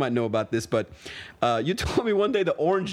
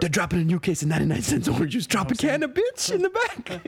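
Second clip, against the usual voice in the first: rising, building hype call; excited hype announcement; 3 semitones higher than usual. Pretend. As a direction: announce it like a sneaker drop. Hyping the secret juice drop.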